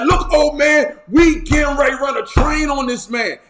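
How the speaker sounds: loud, high-pitched mob threat; insistent, confrontational hollering; threatening shout. Acted. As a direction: holler a warning at him. Mob threatens old man.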